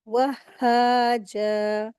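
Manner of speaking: "वहाजा" slow, held as "wah-haa-jaa" with long vowels; doubled-h recitation. Shown as a demonstration. Teacher reciting for students, slow shadda recitation. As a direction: close "वहाजा" on a first h, then stretch "haa-jaa". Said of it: "अलिफ मद्दा के साथ खींच के पढ़ेंगे".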